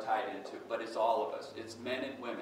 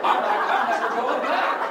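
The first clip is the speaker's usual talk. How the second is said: slow, laughing outburst, higher and louder; 12 semitones higher, 15 dB louder, slower than usual. Retold or imitated. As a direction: burst out laughing as you say it. Laughing at absurd mechanic joke.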